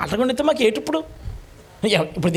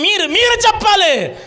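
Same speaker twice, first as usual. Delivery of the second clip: much higher, louder, slow; raised pulpit emphasis; emphatic preaching cry; 10 semitones higher, 4 dB louder than usual. Real, not acted. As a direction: drive the point home to the congregation.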